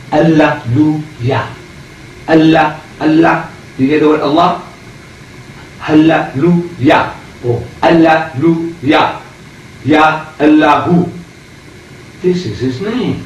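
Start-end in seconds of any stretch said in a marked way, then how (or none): 0.1-11.1 "Hallelujah" said slow so that "Allah" rings out inside it; slow drawn-out hallelujah. Shown as a demonstration. Lecturer to audience, slowly sounding out "Hallelujah" and "Allah". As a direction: stretch "Hallelujah" slowly, a long held "alːa" in the middle. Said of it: "You hear the words?".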